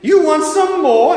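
high, loud, incredulous bellow; a big man thunderstruck at the request; outraged master. Retold or imitated. Workhouse master roaring back at Oliver, loud and incredulous. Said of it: "the big guy looks at him and goes".

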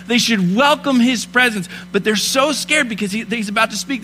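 impassioned Christmas sermon; preaching. Acted. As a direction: preach it with fire to the congregation. Preaching at full force.

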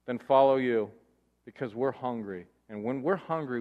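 ordinary talk

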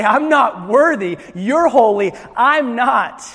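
loud, emphatic acted unworthiness; dramatized humble outcry; overawed confession. People falling before God in awe.

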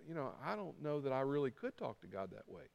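low, soft voicing of private doubt; doubtful inner thought. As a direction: think it aloud, unsure and hushed.

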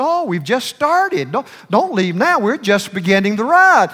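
high, loud pleading friends. Retold or imitated. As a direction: beg him not to leave, high and loud.

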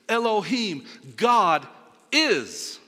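high, loud preaching climax, word by word; emphatic proclamation. Real, not acted. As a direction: proclaim each word at full force, landing on IS.

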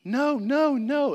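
put-on high, loud wailing no's of a small girl; imitating a screaming child. Pastor imitating his little daughter after her fall, high wailing. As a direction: wail it like a toddler face-down in the dirt. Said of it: "she just laid there screaming".

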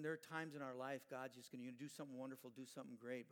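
usual speaking voice

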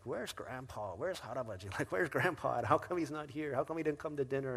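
a hint of pleading children; mock kids calling for Grandpa; kids asking. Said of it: "kids are like".

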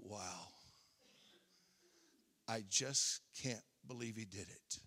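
awed disbelief, barely spoken; hushed amazement. Retold or imitated. Pastor voices the congregation's hushed awe at God. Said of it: "we go".

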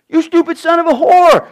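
high, loud, cursing the son; furious curse. Acted. Saul swearing at his son Jonathan in rage. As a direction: hurl the insult at him. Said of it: "he swore at him".

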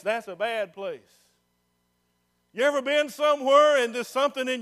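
rising, loud preacher's cry in the voice of God; full-force preaching. Acted. Preacher voicing God to the congregation, loud and rising.

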